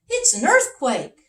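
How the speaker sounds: high, alarmed king; king's voice. Acted. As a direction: cry out like a scared little king. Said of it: "said the king".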